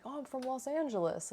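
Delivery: a hint of the owner's American voice; imitating American owner. Imitating the owner answering the friends' question. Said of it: "super American".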